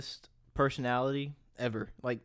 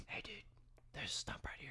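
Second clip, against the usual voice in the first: barely audible mock whisper; retold whisper; 6 semitones higher, 20 dB quieter, faster than usual. Friend ahead warning the hunters, mock whisper. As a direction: whisper a warning far too softly to be heard. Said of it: "No, you whispered".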